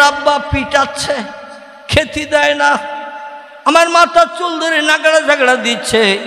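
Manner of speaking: a high, imitated plea to father; complaining daughter. Married daughter telephoning her father about beatings, imitated high complaint.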